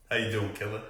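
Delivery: ordinary talk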